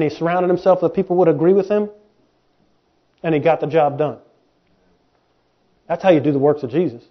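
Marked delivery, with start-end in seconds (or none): none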